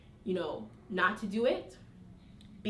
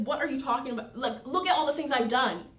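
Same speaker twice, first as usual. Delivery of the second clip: higher, mildly indignant retort; acted exasperation, building louder toward the end; a little mad; 5 semitones higher, 4 dB louder, faster than usual. Heavenly Father reacting to doubting Moses, a little mad. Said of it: "kinda gets a little mad with him".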